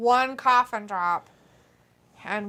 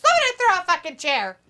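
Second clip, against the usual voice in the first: shouting; 7 semitones higher, 8 dB louder, faster than usual. Real, not acted. Shouting at wrestling match.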